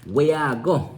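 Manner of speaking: Jamaican Creole. Shown as a demonstration. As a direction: say it the island way. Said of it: "In Creole, they say".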